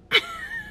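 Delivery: high, soft giggle; helpless high-pitched laugh; laughter. Real, not acted. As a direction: crack up before the reveal. Cracks up before prank reveal.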